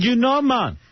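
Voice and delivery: high, put-on Jamaican accent; playful Jamaican impersonation; mock Jamaican accent. Host mimics the Jamaican caller persona.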